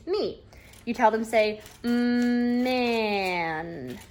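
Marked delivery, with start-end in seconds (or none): 0.1-0.4 "me" as one short, quick word; said fast. Shown as a demonstration. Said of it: "Say it fast". 1.8-4.0 MAN stretched out, long humming "Mm" into "man"; said slow. Shown as a demonstration. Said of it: "they say that slow".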